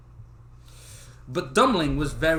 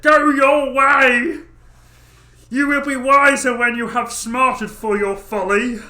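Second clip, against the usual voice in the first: much higher, loud, slow furious father; angry father shout; 10 semitones higher, 13 dB louder, slower than usual. Acted. Father finally sending Dummling off in anger. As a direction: roar him out the door. Father angrily dismissing son.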